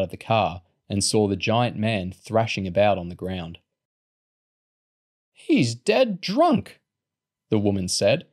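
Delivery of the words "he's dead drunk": put-on elderly lady.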